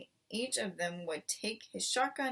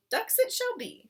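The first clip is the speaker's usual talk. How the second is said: much higher, jaunty agreement; cheerful fox; 14 semitones higher, 7 dB louder than usual. A narrator's reading. Mister Fox promising his wife ducks, jaunty. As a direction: promise it with a flourish.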